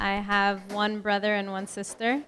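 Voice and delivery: usual speaking voice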